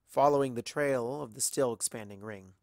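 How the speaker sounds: usual speaking voice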